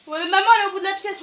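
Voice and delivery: loud, rushed reply to a caller. Real, not acted. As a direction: project it fast down the phone line. Answering on phone, raised voice.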